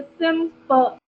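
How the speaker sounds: Simple broken into separate parts; syllable by syllable. Shown as a demonstration. Teacher giving another example, simple split by syllable. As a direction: say simple one piece at a time. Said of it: "three parts को अलग-अलग बोलना पड़ रहा है".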